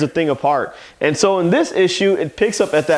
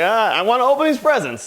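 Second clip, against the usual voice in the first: higher-pitched mock whine of a demanding kid; lightly voiced spoiled child wanting presents; spoiled little girl; 8 semitones higher than usual. Spoiled girl at a birthday party, lightly mimicked demanding.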